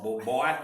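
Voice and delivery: put-on "boy" broken into two syllables, loud; imitated "boy", drawn out as two syllables. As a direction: stretch "boy" into two beats, punched out. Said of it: "made two, two syllables".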